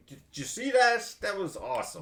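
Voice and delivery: thrilled with himself. Retold or imitated. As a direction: crow over a great shot. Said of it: "I'd be like".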